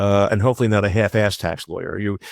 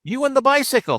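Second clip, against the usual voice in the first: mock yell. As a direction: pretend to holler down from a height. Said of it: "they yell out".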